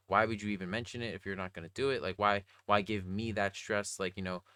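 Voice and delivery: normal talking voice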